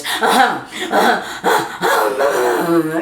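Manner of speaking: mock baby contented grunts "uh-huh"; imitating baby. The nursing baby Barry settling down, imitated grunts. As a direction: make the nursing baby's little satisfied noises. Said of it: "and he goes".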